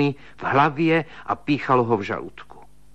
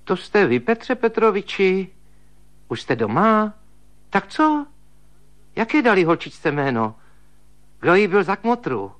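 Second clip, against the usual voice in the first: put-on high, chattering old maid; high, nosy sister-in-law fussing with questions; higher, gossipy woman's voice; old spinster's voice; 8 semitones higher than usual.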